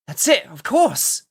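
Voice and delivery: loud, slow sudden realisation; eureka. Acted. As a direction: it just clicked, exclaim it.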